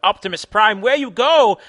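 loud, high calling out; yelling. A narrator's reading. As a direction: yell after the runaway robot. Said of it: "Edison yell".

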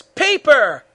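loud, high attack call; shout. A narrator's reading. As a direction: shout out the final move. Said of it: "Goku shouts".

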